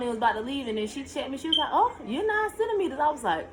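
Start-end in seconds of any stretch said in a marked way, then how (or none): 1.7-3.0 high, quick nurse announcement; cheerful nurse. Retold or imitated. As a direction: nurse surprised at progress. Nurse quoted with good news.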